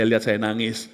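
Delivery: ordinary delivery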